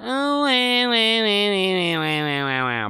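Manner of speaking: high, drawn-out fake crybaby wail sliding down; long mocking wah-wah sob. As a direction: wail like a whiny baby, exaggerated. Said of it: "I'm not gonna sit here and be like".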